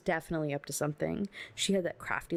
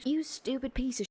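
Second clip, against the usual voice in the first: higher-pitched, scornful outburst; angry cursing; 8 semitones higher than usual. Acted. Mother curses the man who threatened the family, angry.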